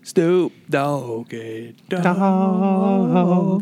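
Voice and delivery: very slow stretched drawl; [name] drawl. Retold or imitated. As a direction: stretch each syllable like [name]. Drawn out [name] name.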